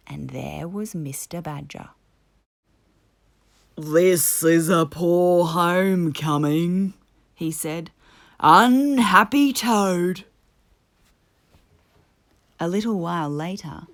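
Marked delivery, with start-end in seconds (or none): 3.7-6.9 slow, louder Badger voice; solemn Badger. A narrator's reading. Badger greeting Toad at the door, solemn and slow. 8.4-10.2 slow, louder Badger voice; solemn Badger. A narrator's reading. Badger greeting Toad at the door, solemn and slow.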